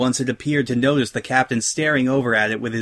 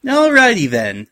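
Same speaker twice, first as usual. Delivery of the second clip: high, bright-pitched and louder, carefree; breezy, chipper opener in a raised voice; 8 semitones higher, 5 dB louder than usual. A narrator's reading. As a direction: toss it off breezily. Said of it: "she said breezily".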